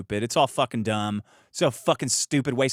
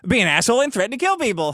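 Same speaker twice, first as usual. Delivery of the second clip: raised, much higher pitch, arguing back; insistent objection; 8 semitones higher, 4 dB louder, faster than usual. Acted. Arguing over what the law says, exasperated objection. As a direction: protest loudly as if pointing at the rule. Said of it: "It's like".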